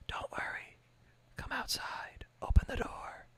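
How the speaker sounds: eerie luring whisper; creepy whisper. Retold or imitated. Streamer mimicking a creature luring victims, creepy whisper. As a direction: whisper it like a monster coaxing you out. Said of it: "they wouldn't be saying".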